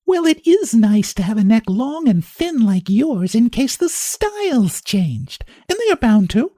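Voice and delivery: high, teasing and cheerful; laughing off the feud with sweet malice; good-naturedly. Acted. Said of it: "she said good-naturedly".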